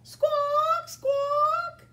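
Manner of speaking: loud high bird squawking; parrot squawk. Acted. Parrot in story.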